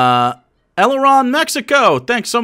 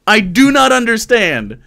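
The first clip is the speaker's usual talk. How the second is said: ordinary delivery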